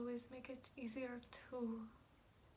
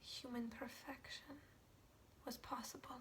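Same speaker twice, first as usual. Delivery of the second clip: halting, reverent softness; quiet and prayerful, searching for words; hushed, pained confession; 7 dB quieter than usual. Acted. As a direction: confess it softly, with long pauses. Hushed confession of love for father.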